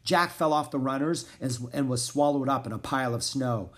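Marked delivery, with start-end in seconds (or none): none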